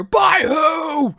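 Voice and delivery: very high, full-volume shouted challenge; sergeant major yell. Acted. Sergeant major questions soldier's sleeping orders, loud yell. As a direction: fire it back as a bellowed demand. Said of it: "yell at the knuckleheads".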